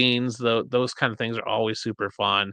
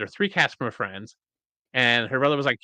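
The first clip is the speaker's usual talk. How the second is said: normal talking voice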